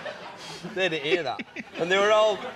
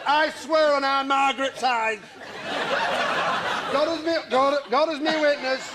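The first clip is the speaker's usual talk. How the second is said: widow voice continues; 5 semitones higher than usual. Retold or imitated. Widow continues mourning tale.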